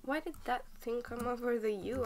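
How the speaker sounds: usual speaking voice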